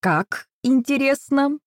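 high, slow mock interest; caustic, drawling retort; sarcastic. A narrator's reading. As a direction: draw it out with acid politeness. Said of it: "съязвила я".

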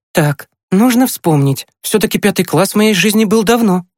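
high girlish voice, recalling with mock nostalgia; joking older sister. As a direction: think back playfully in a high voice. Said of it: "вздохнула Маша и засмеялась". (шутливо, высоким девичьим голосом)

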